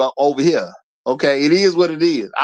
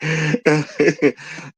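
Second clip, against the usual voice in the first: drawn-out amused chuckling; mocking laugh; 2 semitones lower than usual. Real, not acted. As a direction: laugh at someone's delusion. Laughs at the woman being convinced.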